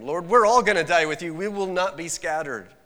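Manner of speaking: loud, high chorus of promises; loud group vow. Acted. Disciples assuring Jesus, a loud high vow. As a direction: shout the pledge together. Disciples all protest loudly.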